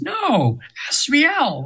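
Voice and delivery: high, light angel voice correcting; a lilting high voice setting the name straight; angel correcting her name. Retold or imitated. Angel corrects the host's pronunciation, high and light. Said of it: "and she says".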